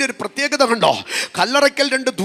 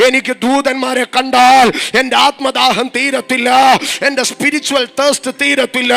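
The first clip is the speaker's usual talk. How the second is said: building from strong preaching to a full shout, louder; fiery preaching; 5 dB louder than usual. Real, not acted. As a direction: preach it harder and harder until you are shouting. (ഉച്ചത്തിൽ ആവേശത്തോടെയുള്ള പ്രസംഗം)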